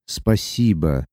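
slow, deeply felt thank-you; heartfelt thanks. A narrator's reading. Luka thanking Sati again, heartfelt and slow. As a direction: let the gratitude sink into the word. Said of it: "И снова прочувственно произнёс".

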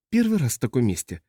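plain reading voice

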